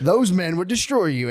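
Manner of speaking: hammed-up grave warning; warning father. Father warns Annalise about those men, alarmed.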